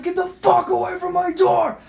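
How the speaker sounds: loud, very high yell; full-throated retold shout; retold screaming. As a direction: scream through the door, re-enacting rage. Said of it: "I'm screaming at my fucking door".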